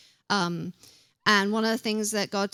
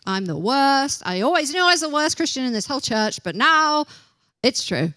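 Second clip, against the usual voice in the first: higher, louder, faster mock wail of self-pity; mock self-condemning believer; 5 semitones higher, 5 dB louder than usual. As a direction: ham up the guilty churchgoer.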